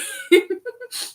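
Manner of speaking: real high-pitched giggle. Real, not acted. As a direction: giggle high at your own joke. Laughs at her own joke.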